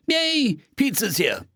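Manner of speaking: put-on high, drawn-out childlike yay; delighted squeal, settling into happy announcement; gleeful cheer. A narrator's reading. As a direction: celebrate the food's arrival. Narrator cheers as pizza arrives.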